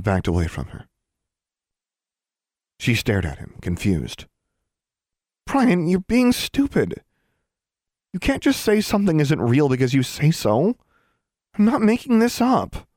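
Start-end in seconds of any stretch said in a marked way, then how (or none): 5.5-12.9 high-pitched, indignant arguing; exasperated protest. A narrator's reading. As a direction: argue back, stretching the key words. Girl arguing with her friend.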